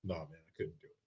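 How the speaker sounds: mock the friend's refusal, low and quiet. Retelling the friend's refusal to viewers, low mock voice. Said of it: "For real, you wouldn't go?".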